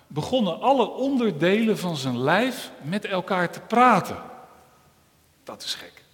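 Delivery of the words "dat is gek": whisper. Acted. Storyteller's hushed aside.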